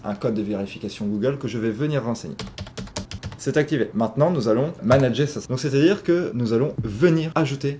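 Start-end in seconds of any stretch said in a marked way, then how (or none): none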